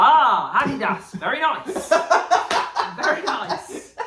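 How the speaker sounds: high, loud, overeager salesman voice; gushing shopkeeper. Retold or imitated. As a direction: ham up a pushy shopkeeper praising everything. Shopkeeper imitated, gushing praise.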